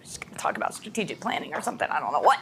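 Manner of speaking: weary colleagues. Retold or imitated. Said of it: "They're like".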